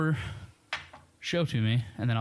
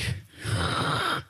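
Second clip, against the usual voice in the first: mock gasp; 10 semitones higher than usual. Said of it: "and he's like".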